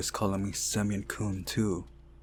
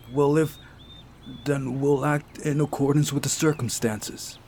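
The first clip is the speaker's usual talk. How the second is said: Masha's voice; 5 semitones higher than usual. Acted. Masha near tears, arguing.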